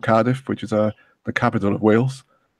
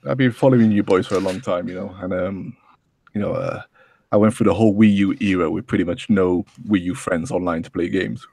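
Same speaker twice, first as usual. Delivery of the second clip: ordinary talk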